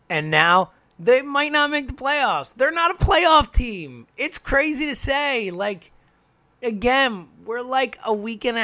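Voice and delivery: high, loud disbelief; can't believe what you're saying; incredulous. Real, not acted. Fan stunned the team may miss the playoffs, incredulous.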